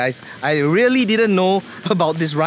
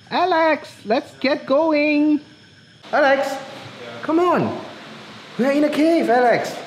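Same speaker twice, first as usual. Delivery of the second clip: calling out; 7 semitones higher than usual. Real, not acted. Calling out to friend in cave.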